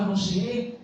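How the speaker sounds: usual speaking voice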